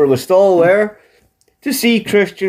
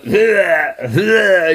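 two short faked retching sounds; hammed-up vomit noises; mock retching; 3 semitones higher than usual. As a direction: gag it out like you're about to be sick. [name] imitates vomiting in bathroom.

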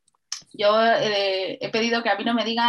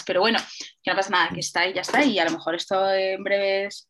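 ordinary talk

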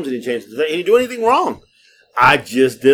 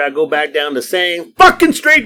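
ordinary talk, nothing marked

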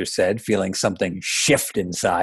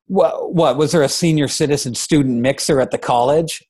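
high, louder incredulous jab; zing her with a mocking question; stammered, scornful dad's dig; sarcastic zinger; 5 semitones higher, 4 dB louder than usual. Father sarcastically zinging his daughter.

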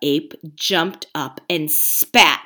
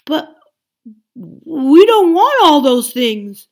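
slow, higher, louder bear voice; old bear; 8 semitones higher, 10 dB louder, slower than usual. Acted. Old bear objecting to the ape, slow and earnest. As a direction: plead like a simple old bear. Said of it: "said an old bear".

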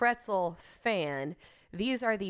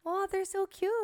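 delighted; 11 semitones higher than usual. Real, not acted. Delighted by tiny cookies.

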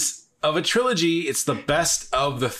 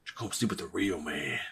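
low tough guy taunt; 5 semitones lower, 11 dB quieter than usual. Retold or imitated. Continuing the Clubber Lang quote.

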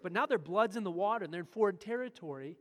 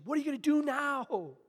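higher and louder desperate question; desperate plea; 7 semitones higher, 9 dB louder than usual. Acted. Joshua desperately asking God, higher and louder. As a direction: ask in desperation.

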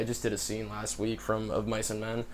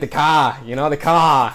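loud, higher Boston "cah" on "car"; Boston accent; 4 semitones higher, 11 dB louder, slower than usual. Acted. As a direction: show off a Boston accent, loudly. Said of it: "can you do Boston?".

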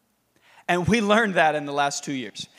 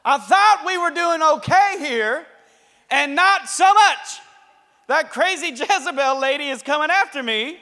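whiny complaining Elijah; 7 semitones higher, 5 dB louder than usual. Acted. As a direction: whine it like a wronged servant. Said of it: "And Elijah's like".

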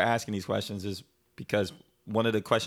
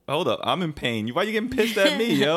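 mock indignant, pitched way up and louder; hammed-up hurt protest, higher and louder; husband replaying his protest to his upset wife, higher and louder; indignant retort; 9 semitones higher, 5 dB louder than usual. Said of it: "I'm looking at her like".

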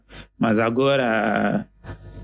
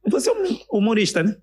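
imitating girlfriend; 10 semitones higher than usual. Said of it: "Baby, di".

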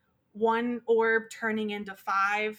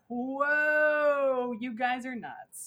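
long higher whoa, then slow appalled verdict; mock alien reaction; 3 semitones higher, much slower than usual. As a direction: play a visitor taken aback, drawing it out. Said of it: "they came here and were like".

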